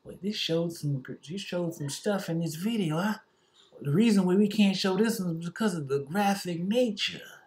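lightly voiced smug platform; mock YouTube censor.

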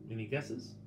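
plain reading voice

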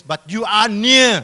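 loud, high, drawn-out "But you are near"; emphatic proclamation. Acted. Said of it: "Look at the word near".

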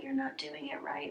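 mock whisper, fading out. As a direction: whisper it like a nagging echo. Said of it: "I get, like, eight thousand comments like".

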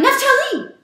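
very high, loud cry of alarm; alarmed shout. Acted. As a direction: yell his name in fright. Mother cries out in alarm.

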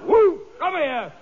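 loud high whoop and call; shouting at the dog. Acted. Comedian calling sharply to the unruly dog, loud whoop.

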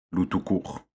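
répété d'un ton amusé, plus doux. A narrator's reading. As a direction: reprendre le nom avec malice. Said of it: "fit Gros Louis, amusé". (Gros Louis, amusé, répète le nom de Loup)

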